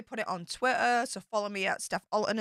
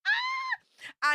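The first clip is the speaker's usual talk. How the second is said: sudden high excited shriek; a squeal of pure excitement. Real, not acted. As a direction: let out a high thrilled shriek. Excited about Jurassic Park visit.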